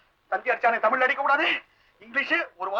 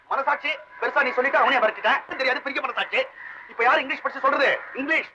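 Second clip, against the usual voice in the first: normal talking voice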